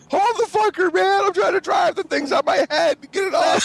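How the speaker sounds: high, frantic shout at a buddy; retold panic, hollered high; panicked yelling. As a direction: yell for help while driving with a cat on your head. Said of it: "I'm like".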